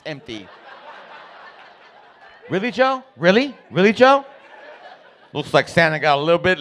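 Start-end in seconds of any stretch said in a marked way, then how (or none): 2.5-4.2 high, louder incredulous questions; wife scolding her husband in rising disbelief; mom's exasperation. Retold or imitated. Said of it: "then she would always say".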